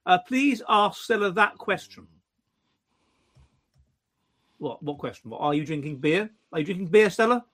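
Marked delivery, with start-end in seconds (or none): none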